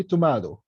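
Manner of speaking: tomato said British, "tuh-MAH-doh"; British pronunciation. Shown as a demonstration. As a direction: say tomato with a long AH in the middle. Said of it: "بالبريتش أو بالآيرش". (نطق tomato بالبريطاني "تومادو")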